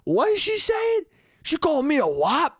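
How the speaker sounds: very high, slow falsetto bafflement; high-pitched mock outrage; squeaky confused old guy. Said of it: "the Italians who are just like".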